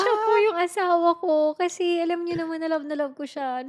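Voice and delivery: tearful confession that she still loves him; crying. Real, not acted. Breaks down retelling her prayer. (umiiyak habang nagkukuwento)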